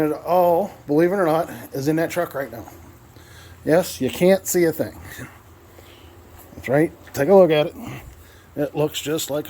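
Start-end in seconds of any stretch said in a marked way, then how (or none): none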